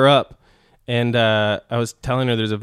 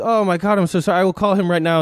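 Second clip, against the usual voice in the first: higher, fast gushing sorry; mock frantic apology; 7 semitones higher, faster than usual. Landlady apologizing in a rush, higher and fast. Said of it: "she was".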